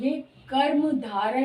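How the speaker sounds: usual speaking voice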